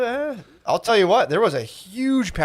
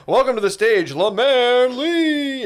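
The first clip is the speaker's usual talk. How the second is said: stage announcer; 8 semitones higher, much slower than usual. Retold or imitated. Club staff introduces a comic to the crowd, mock announcer. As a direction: announce the comic, drawing out the last name. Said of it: "So they were like".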